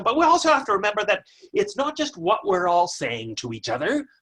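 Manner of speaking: ordinary talk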